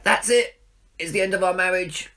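a hammed-up loud cry, pitched high; mock outburst. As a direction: play up the overreaction to the row with the wife. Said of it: "and I'll be like".

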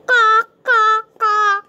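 loud, high squawked caws; raucous high parrot calls. Pretend. Imitating the parrot pen.

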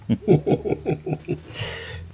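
airy high-pitched giggle; high breathy laugh. Real, not acted. Laughs after reading letter.

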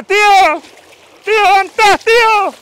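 calling out for uncle. Acted. Searching the river for his lost uncle, shouting his name. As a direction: shout high and loud across the river.

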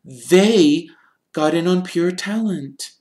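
put-on high, crisp girl's retort; lightly voiced sharp girl; sharp Hermione. A narrator's reading. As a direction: cut him down sharply. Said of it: "said Hermione sharply".

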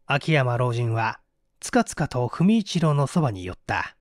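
ordinary delivery, nothing marked

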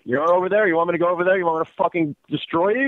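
angry. Real, not acted. Victim threatens prank caller.

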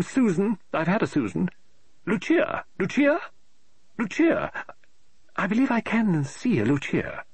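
high, fussy woman's voice, rising in exasperation; an eccentric lady author tearing her hair; fretting novelist. Acted. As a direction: novelist complaining aloud about her plot, high and exasperated. Mrs Oliver tries out names.

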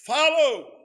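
"Follow." barked high and loud; drill sergeant command. Shown as a demonstration. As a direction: order the men to follow. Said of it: "We could say it like a drill sergeant".

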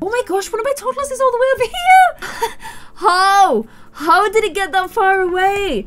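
high laughing outcry; incredulous squeal. Real, not acted. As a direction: squeal in amused disbelief. Toddler wandered far away.

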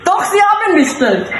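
prank pizza delivery voice. Pretend. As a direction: play a pushy Italian pizza delivery man. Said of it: "gebe mich als Pizzabote aus".

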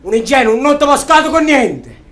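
loud, high and slow contempt; slow scornful yell at the dead dreamer; scornful shout. Acted. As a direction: spit out the insult slowly. Scornful radio tirade.

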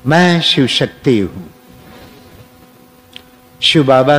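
slow, measured meditation voice with long pauses; guided affirmation. Acted. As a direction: lead listeners phrase by phrase, leaving room to repeat.

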